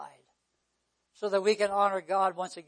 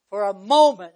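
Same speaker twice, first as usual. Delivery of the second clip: "moment" hit much louder than the words around it; stress on moment; 13 dB louder than usual. Real, not acted. Preacher warning about infidelity, "moment" heavily emphasized. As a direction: punch the word "moment". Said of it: "I emphasize the word again".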